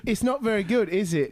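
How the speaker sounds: high, polite mock-British complaint; British accent. British gamer mildly criticizing, British accent. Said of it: "British people".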